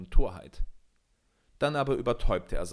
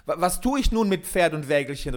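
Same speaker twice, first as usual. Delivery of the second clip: loud, higher, indignant cry; heated, raised-voice complaint; angry outburst; 6 semitones higher, 7 dB louder than usual. A narrator's reading. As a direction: burst out in anger and frustration. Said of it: "geriet er in Zorn und Eifer".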